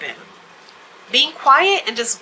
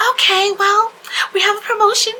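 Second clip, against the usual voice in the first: very high, loud sales-clerk chirp; overdone customer-service voice; extra-friendly; 12 semitones higher, 5 dB louder than usual. Retold or imitated. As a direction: pitch it way up, pushing relentless politeness at a grumpy customer. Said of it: "I just got extra".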